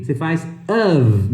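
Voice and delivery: "of" said as "av", ending in a V sound. Shown as a demonstration. Teacher modelling "of" for students, ending in a V sound. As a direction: say "of" with a short a and a buzzing v at the end. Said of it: "É som de V".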